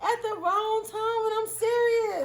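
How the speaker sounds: high, drawn-out laughing whine; embarrassed wail. Real, not acted. Speaker messed up a name while serious, embarrassed wail. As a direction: wail slowly at your own blunder.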